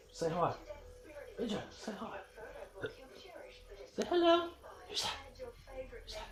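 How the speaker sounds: high singsong dog voice; pet talk. Real, not acted. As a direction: owner sweet-talking his dog on camera. Talking to dog on camera.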